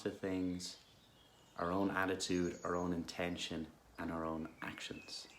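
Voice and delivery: calm, unhurried guidance with long pauses; guided meditation. Real, not acted. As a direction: gently guide listeners into stillness.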